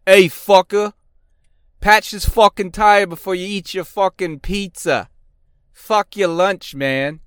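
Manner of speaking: high, loud, pushy New York trash talk; trash-talk the tech like a loud New York shop guy. Retold or imitated. A New York shop guy trash-talking a tech about his pizza, loud and high. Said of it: "best shit-talking shops".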